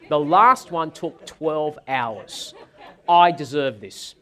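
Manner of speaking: slow, laughing through the punchline; laughing while quoting his wife's final argument; laughing mock plea. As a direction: let laughter break through the demand.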